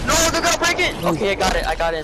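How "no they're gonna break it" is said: alarmed shout. Real, not acted. Panicked gamer teammate.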